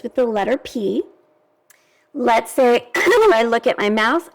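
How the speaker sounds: ordinary talk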